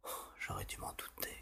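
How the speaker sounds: rueful whispered aside; regretful whisper. Acted. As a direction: whisper it to yourself. Parent regretting quietly.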